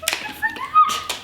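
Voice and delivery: squeaky high panic; freaking out. Real, not acted. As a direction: panic at the raw bird. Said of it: "I'm freaking out".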